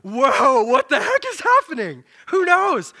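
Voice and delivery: loud, rising astonished whoa; all creation shouting in disbelief; amazed creation. Pretend. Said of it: "All of creation is like".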